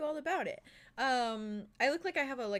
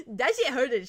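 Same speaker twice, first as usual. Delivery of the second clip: laughing; 5 semitones higher, 7 dB louder than usual. Real, not acted. Laughing repeat.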